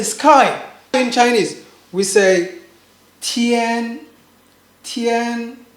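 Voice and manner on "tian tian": TIAN held long and level; sweet first tone. Shown as a demonstration. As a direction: draw out tian without any fall. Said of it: "I just call the sweet tone".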